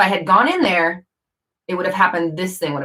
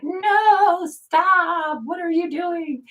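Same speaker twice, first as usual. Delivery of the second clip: high squeaky inner voice; 12 semitones higher, much slower than usual. Retold or imitated. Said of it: "And I was like".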